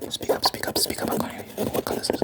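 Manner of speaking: soft ASMR role-play whisper. Pretend. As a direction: whisper it all without any voice. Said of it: "Why are you whispering?".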